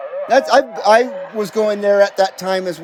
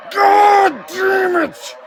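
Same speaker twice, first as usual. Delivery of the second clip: frustrated outburst; 11 semitones higher, much slower than usual. Real, not acted. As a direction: blurt it out at the end of your rope. Frustrated outburst during radio attempt.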